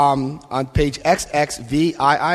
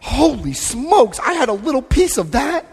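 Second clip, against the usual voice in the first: amazed; 7 semitones higher than usual. Retold or imitated. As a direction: savor the wonder of it. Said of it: "I'm like".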